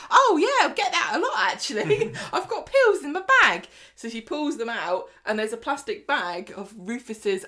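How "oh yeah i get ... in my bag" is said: much higher and louder, breezy and bright; shrill chirpy woman. Retold or imitated. Woman offering Stephen pills, loud high chirpy voice. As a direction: toss it off like a chirpy, brassy woman. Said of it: "She's like".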